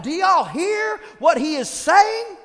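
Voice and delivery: slow, loud outraged shout. Retold or imitated. David shouting at the soldiers, outraged. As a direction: demand they listen, furious.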